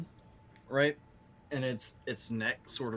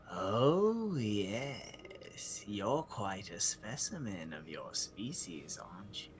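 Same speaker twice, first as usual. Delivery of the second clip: slow, drawn-out creature voice with swooping pitch; haughty bird creature; 5 dB quieter, slower than usual. Acted. Great bird sizing up Bruce, haughty creature voice. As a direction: purr it out slowly, appraising the prey.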